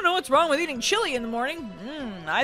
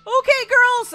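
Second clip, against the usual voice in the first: high, loud dad's call; 13 semitones higher, 10 dB louder than usual. Acted. Dad calling girls to breakfast, loud and high. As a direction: call the kids in to eat.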